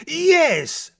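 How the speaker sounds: high, loud drawn-out yes; Papa answering. A narrator's reading. Father answering his daughter's interruption, high loud yes. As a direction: burst out a high, emphatic yes. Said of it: "says Papa".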